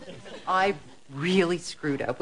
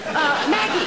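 calling across the house; 10 semitones higher, 4 dB louder than usual. Acted. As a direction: shout the name to another room. Calling out for Maggie.

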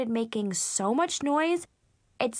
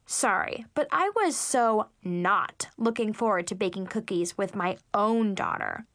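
usual speaking voice

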